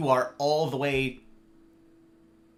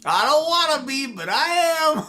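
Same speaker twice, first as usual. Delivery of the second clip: high, slow sleepy whine; groggy mock voice; 12 semitones higher, 6 dB louder than usual. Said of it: "You're like".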